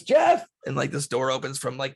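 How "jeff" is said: high, loud call to someone behind; sharp raised-pitch yell; yelling back. Acted. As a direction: holler the name to summon him. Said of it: "he yells back".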